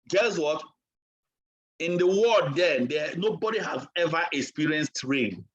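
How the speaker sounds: ordinary talk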